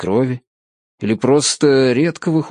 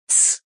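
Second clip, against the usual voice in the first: soft voiceless "tss"; quiet sharp shush; shushing hiss; 6 dB quieter than usual. A narrator's reading. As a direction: hush him with a short hiss. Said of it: "шикнула дверь".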